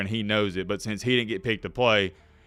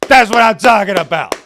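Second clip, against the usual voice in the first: louder, higher, fired-up praise; loud cheering; 6 semitones higher, 11 dB louder than usual. Real, not acted. Host applauding the commissioner, loud and fired up. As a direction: hype it up like a fan in the stands. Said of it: "I gotta clap it up".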